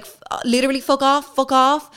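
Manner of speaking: desperate brush-off; frantic shooing. Retold or imitated. To the French man, frantic and fast.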